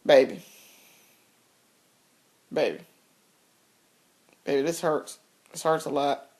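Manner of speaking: soft, low, pained begging to her husband; mock-weak plea, hurting and soft; pained pleading. Wife begging husband for pills, soft and pained.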